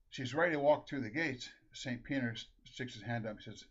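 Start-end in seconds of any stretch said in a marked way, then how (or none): none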